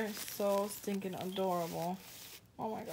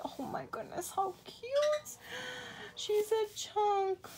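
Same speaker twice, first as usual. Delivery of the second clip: very high, slow, cooing squeal; high sing-song gushing at a doll; 11 semitones higher, slower than usual. Real, not acted.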